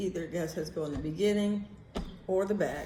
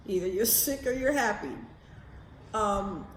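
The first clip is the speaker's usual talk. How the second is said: ordinary delivery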